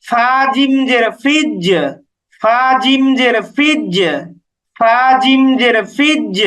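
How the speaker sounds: slow spelling, then "ফিজ" with bounced j; bouncing qalqalah. Shown as a demonstration. Teacher repeats the spelling for learners, slow bouncing qalqalah. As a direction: release the final j of "ফিজ" with a small echo. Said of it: "এটা হচ্ছে কলকলা".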